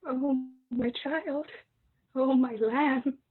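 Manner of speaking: soft, slow, broken; barely able to say it; quiet slow heartbreak; hushed grief. Acted. Mother breaks terrible news.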